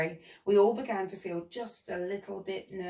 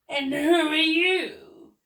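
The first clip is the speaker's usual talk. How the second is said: high, loud, slow question; imperious Duke; 8 semitones higher, 8 dB louder, much slower than usual. A narrator's reading. Duke questions Billy, imperious. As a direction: boom it slowly at a newcomer.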